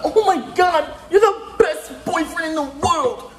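put-on high, slow squeaky girly gush; mocking girlfriend. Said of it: "That's exactly how she sounded".

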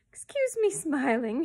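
high, loud, drawn-out gloating; crying out. A narrator's reading. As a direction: crow with barely held amusement. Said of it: "he cried".

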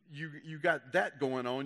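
usual speaking voice

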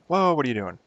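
sudden loud high "whoa" of alarm; jolted by a mistake, blurt it out; startled outcry. Real, not acted. Game goes wrong suddenly.

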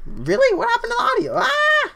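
very high-pitched, squealed disbelief; alarmed squeal. Real, not acted. Streamer told the audio is not fine, alarmed squeal. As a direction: squeal it in dismay, ending in a cry. Reacts to bad audio report.

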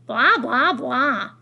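loud, mocking sing-out over the other speaker; dismissive blahs. A narrator's reading. Grandmother waving away her son's legal talk, dismissive. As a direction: drown out the explanation with scorn.

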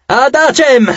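high-pitched battle order; commander's yell. A narrator's reading. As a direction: order the troops into line. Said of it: "urlò".

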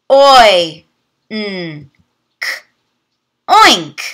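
"Oi, n, k" said as separate sounds, then blended into "oink"; teacher sounding out letters for children. Shown as a demonstration. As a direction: segment each phoneme slowly, then blend. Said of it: "Let's practice together".

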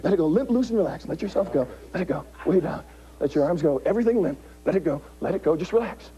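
soothing hypnotist relaxation; calm her down, let go. Acted.

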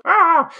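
pterodactyl screech. Pretend. Mimics pterodactyl after pizza commercial.

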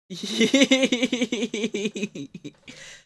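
high, drawn-out giggle trailing down; long giggle. Real, not acted. Streamer amused at squishing the little guy, long giggle.

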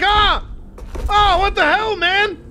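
high, loud, hammed-up startled cry; mock startled yelp. Voicing the startled monster, high and loud. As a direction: yelp it like the monster got ambushed. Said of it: "He's like".

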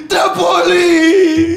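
high, wailing, long-drawn yell; hammed-up drunk fan begging at full volume; mock drunken shout. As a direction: wail the request like a sozzled uncle. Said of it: "Se subió a la silla".

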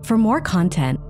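ordinary delivery, nothing marked

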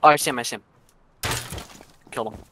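normal talking voice

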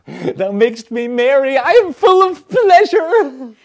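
high loud joyful cry; gleeful cry. Acted. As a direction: shout out delight. Caliban gleeful.

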